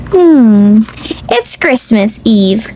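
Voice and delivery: ordinary delivery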